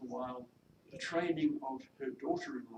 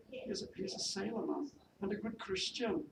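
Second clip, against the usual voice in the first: higher-pitched Welsh maid, unraised; attempted Welsh accent; 7 semitones higher than usual. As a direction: defend your sweetheart in a Welsh accent. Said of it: "my attempt at a Welsh accent".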